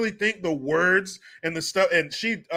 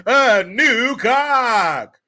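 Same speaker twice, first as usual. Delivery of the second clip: louder, very slow, drawn-out reveal; mock game show host; 5 semitones higher, 5 dB louder, much slower than usual. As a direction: announce the prize like a cheesy game show.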